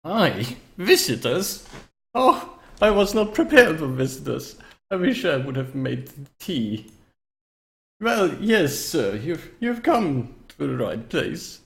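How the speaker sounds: put-on slow, swooping, theatrical old host; old necromancer. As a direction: play a fussy old necromancer greeting visitors. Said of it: "every time you talk as this necromancer".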